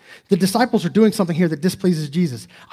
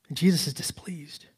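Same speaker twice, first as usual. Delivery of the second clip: whispered gravely; hushed; 8 dB quieter than usual. Acted. Preacher to congregation on Jesus' displeasure, whispered. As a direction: drop to a whisper for weight.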